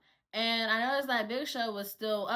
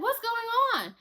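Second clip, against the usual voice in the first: high-pitched retold question; bewildered demand; 11 semitones higher, 4 dB louder than usual. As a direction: ask it high, wanting an answer. Retold puzzled demand among coworkers.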